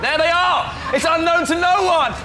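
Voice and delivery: loud, thrilled hype call; excited shout. Real, not acted. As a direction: announce them with big excitement. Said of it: "so excited".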